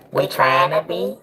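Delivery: usual speaking voice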